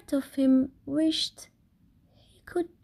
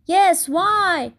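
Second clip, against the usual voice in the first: high, louder call; 8 semitones higher, 5 dB louder than usual. Acted. The Dingoes calling up to Kevin, high and louder. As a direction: call up eagerly from below. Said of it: "called the Dingoes".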